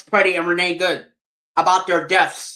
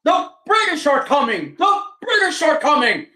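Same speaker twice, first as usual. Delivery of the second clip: high mock rider's call; a lightly yelled town-crier warning; yelling out; 9 semitones higher than usual. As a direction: call out the alarm in a higher voice. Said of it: "people yelling in horses".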